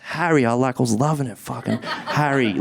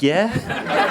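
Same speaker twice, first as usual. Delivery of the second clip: put-on kids answering; 9 semitones higher than usual. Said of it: "Five kids would be like".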